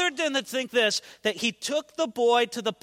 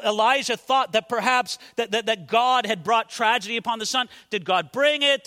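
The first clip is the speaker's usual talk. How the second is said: normal talking voice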